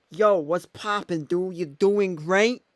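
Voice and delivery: hammed-up Boston accent drawn out; a light Boston accent read into the phone. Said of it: "Said with a Boston accent".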